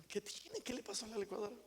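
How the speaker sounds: husband gently asking what happened; soft concerned question. Acted. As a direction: ask tenderly. Tender husband asks softly.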